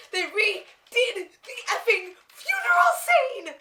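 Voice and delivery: high, loud, slow outburst with laughter; laughing rant. Real, not acted. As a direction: explode into the rant. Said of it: "here comes the rant".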